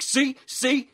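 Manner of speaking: high, loud, excited impression; gleeful high-pitched mimicry, laughing through it; Miles. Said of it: "I dig your impression of him too".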